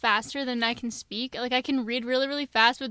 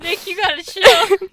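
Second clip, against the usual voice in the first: loud, higher, slow scolding; exasperated; 7 semitones higher, 9 dB louder, slower than usual. Real, not acted. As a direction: scold him in disbelief. Exasperated at character.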